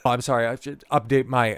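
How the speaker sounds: usual speaking voice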